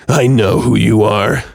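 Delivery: slow, menacing growl through clenched teeth; a slow threatening growl at the photographer; growled through gritted teeth. A narrator's reading. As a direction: grit your teeth and growl it out slowly. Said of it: "growled through gritted teeth".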